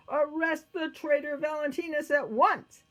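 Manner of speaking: high, loud emperor's command; commanding order. A narrator's reading. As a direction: bark out an order to the guards. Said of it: "he ordered his guards".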